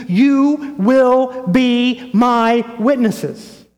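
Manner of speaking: "You... will... be... my... witnesses", stretched out with full emphasis; emphatic command. Retold or imitated. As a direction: proclaim "You will be my witnesses" one weighty word at a time. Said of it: "he emphatically says".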